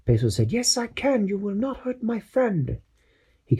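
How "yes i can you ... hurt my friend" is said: high squeaky sidekick voice; eager little crewman, pitched way up; Peso's high voice. Acted. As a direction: chirp it like an eager little helper. Peso defiant.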